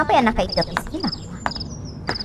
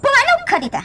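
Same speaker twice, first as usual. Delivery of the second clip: angry demand; 14 semitones higher, faster than usual. Acted. Boss confronts employee over drawer.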